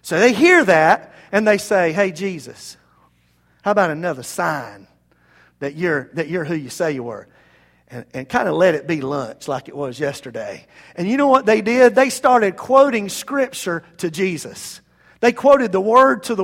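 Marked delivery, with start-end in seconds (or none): 1.9-10.7 low, softer, casual wheedle; sly wheedling. Acted. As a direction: sidle up with a low-key request. Mock crowd angling for lunch.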